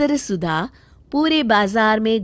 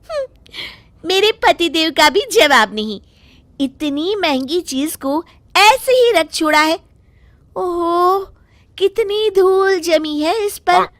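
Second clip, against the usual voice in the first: put-on higher, chatty young village wife; village wife Sudha; 8 semitones higher, 4 dB louder than usual. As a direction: naive housewife musing to herself. Narrator voices wife Sudha. (भोली गाँव की बहू की आवाज़)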